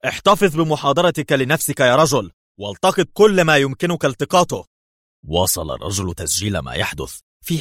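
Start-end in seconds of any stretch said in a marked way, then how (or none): 0.0-4.7 sharp, high and loud rebuke. Acted. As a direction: shut the lecturer up sharply. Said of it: "وقاطعه برونو في حدة".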